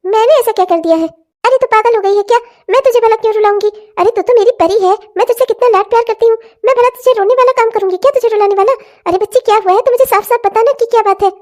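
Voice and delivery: high, fast mother's voice fussing over her daughter; a fretting mother coaxing her crying girl; worried old mother. Acted. (बेटी को पुचकारती चिंतित माँ)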